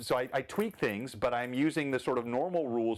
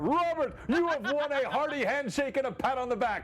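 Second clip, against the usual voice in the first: game show announcer; 13 semitones higher than usual. Pretend. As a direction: announce the prize like TV. Game show prize reveal.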